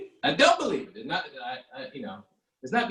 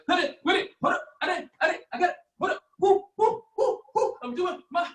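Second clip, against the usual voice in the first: high, panting rapper voice hyped between woos; mocking new rappers who sound like they're working out, high and puffing; winded workout rapper. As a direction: rap like you're mid jumping jacks, out of breath. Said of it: "sound like you working out all the time".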